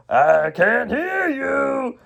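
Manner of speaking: patronizing high, drawn-out shout; hammed-up villain taunt; Garmadon. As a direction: goad the crowd, stretching every word. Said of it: "that voice is patronizing".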